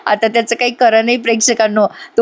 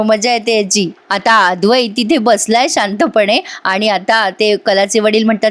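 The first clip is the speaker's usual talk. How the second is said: normal talking voice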